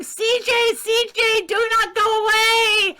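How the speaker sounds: high, loud pleading crowd cry; imitated screaming. Retelling residents begging the entertainer to stay, mock screaming. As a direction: scream it like adoring fans begging. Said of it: "they were screaming".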